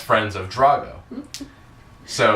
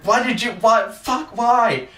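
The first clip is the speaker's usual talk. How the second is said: high, sputtering mock fury; exasperated outrage; 13 semitones higher, 3 dB louder, slower than usual. Acting out a teammate demanding an explanation, exasperated. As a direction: imitate baffled, furious demands. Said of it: "It's like".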